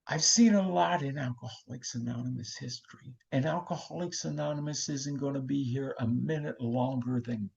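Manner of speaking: soft, fading to a whisper; Lois Wilson hushed. Retold or imitated. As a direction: reassure them in a hush. Lois calming the delegates.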